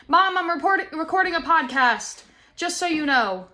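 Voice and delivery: high, loud call across the room; calling out to mom. Real, not acted. Daughter tells mom she's recording a podcast, loud call. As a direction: raise your voice so mom hears.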